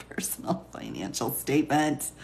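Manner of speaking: soft, breathy repeat of the phrase; whisper. Pretend. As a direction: whisper it like a secret aside.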